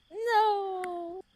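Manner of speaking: high, loud drawn-out no; pleading protest. Real, not acted. Partner begging the reader not to stop, pleading protest. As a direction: wail it in protest.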